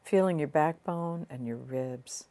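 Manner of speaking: soft, unhurried meditation voice with long pauses; guided meditation. Real, not acted. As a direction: ease the listener gently inward, leaving space between phrases.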